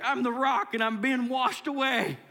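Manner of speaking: high, loud, hammed-up wail; mock distressed cry. Congregant picturing self as the battered rock, mock distressed cry. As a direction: cry out as if being swept off. Acted cry of someone overwhelmed.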